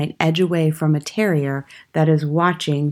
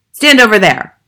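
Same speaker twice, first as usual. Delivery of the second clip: loud, higher-pitched bellowed command; Trunchbull's order; 5 semitones higher, 11 dB louder than usual. A narrator's reading. Headmistress positions the boy on stage, bellowing. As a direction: order him about at full volume. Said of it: "the Trunchbull ordered".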